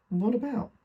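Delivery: high, rising question; puzzled query. Acted. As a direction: ask sharply upward, taken aback. Son questions mother in surprise.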